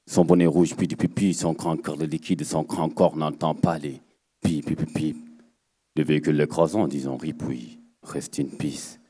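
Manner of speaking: low, quiet slam verse, each line weighed; low slam refrain. Acted. Slam poet brooding to the crowd, low and quiet.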